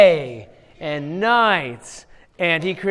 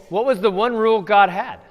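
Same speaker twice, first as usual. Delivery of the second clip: normal talking voice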